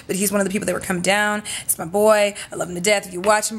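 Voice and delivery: usual speaking voice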